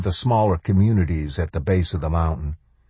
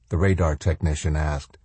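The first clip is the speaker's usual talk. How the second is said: plain reading voice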